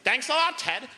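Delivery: high, mock-grateful jab; sarcastic thanks to the father-in-law; sarcastic dad. Dad sarcastically thanks grandfather, pitched high. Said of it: "And he's like".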